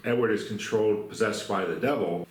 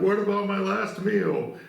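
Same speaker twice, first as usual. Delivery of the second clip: high, slow, whiny mock-crying; imitating crying Edward; 11 semitones higher than usual. Edward asking the guard about his meal, mock crying. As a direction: plead like a tearful child. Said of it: "a later scene where it's Edward crying".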